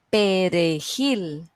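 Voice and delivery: perejil said slowly, stress on the last syllable jil; stressed-syllable demo. Shown as a demonstration. Teacher quizzing students on the stressed syllable, slow perejil with stress on jil. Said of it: "¿Cuál es la sílaba tónica?".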